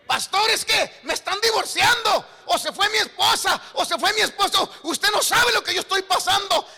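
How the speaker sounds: high, shouted mock pleading to the pastor; distressed church member. Church member telling the pastor of divorce, acted frantic complaint. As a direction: plead loudly as an acted troubled believer.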